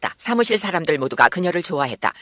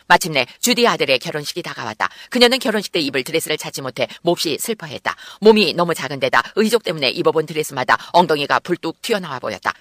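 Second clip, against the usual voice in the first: plain reading voice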